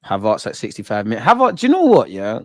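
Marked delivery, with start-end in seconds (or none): 1.3-2.1 surprised. Real, not acted. Surprised at the substitution.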